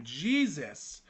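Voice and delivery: ordinary talk, nothing marked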